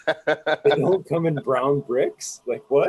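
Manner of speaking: slow, high, disbelieving; amazed disbelief. Retold or imitated. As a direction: play it stunned and slow. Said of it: "I was like".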